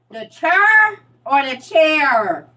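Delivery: first "chair" as "churr", second with clear AIR; slow, loud, "chair" two ways, churr then chair; contrasted pronunciations. Shown as a demonstration. Mocking Saint Louis talk, "chair" as churr, then standard. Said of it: "Like, Saint Louis is her".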